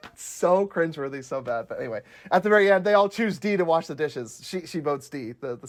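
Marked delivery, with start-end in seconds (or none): none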